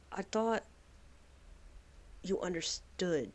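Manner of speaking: heartbroken wife. Acted. Salemla telling her wife she thought she understood, hurt and soft. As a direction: say it quietly, betrayed. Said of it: "So she's like".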